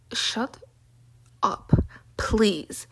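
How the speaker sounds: exasperated, word by word; weary dismissal, broken into pieces; fed-up retort. Retold or imitated. Acted weary retort to nosy people.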